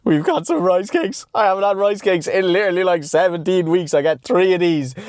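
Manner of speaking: high, slowed delight over a treat; giddy excitement. Real, not acted. Showing the boys his first rice cakes in months, giddy.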